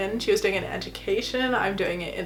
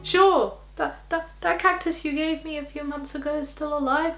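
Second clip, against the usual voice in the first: high, strained pretend-everything's-fine voice; badly faked breezy reassurance; falsely reassuring; 6 semitones higher than usual. Student saying to parents that all is fine, falsely reassuring. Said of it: "saying to your parents".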